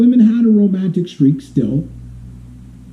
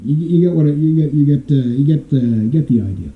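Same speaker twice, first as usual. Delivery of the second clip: ordinary delivery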